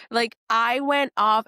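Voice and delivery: ordinary talk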